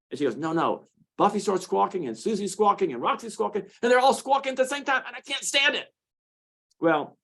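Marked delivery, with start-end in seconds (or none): 0.4-5.9 exasperated wife. Retold or imitated. Wife voiced in story.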